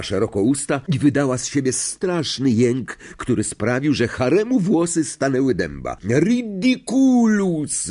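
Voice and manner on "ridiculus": high, long-drawn incantation; slow soaring cry; yelled spell. A narrator's reading. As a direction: yell the spell, dragging it out. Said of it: "wrzasnął Simus".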